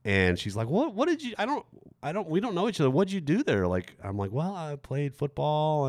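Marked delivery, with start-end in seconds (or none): 0.7-3.7 high-pitched girl imitation, stumbling; puzzled classmate. Classmate questioning a schoolmate she doesn't know, high and puzzled. 4.3-5.8 high, slow, drawn-out mock bluff; stalling fibber. Student making stuff up for a classmate, stalling slowly. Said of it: "I'm, like, making stuff up".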